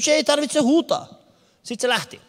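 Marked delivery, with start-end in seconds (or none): 0.1-1.1 calm taxi driver. Retold or imitated. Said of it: "Se olisi, että oi".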